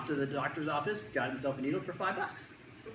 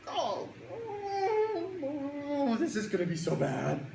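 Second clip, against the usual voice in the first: put-on dreading kid; 9 semitones higher, much slower than usual.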